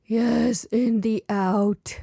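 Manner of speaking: slow, gentle reassurance; gentle echo. A narrator's reading. Mother gently repeats her daughter's words back to her, slow and warm.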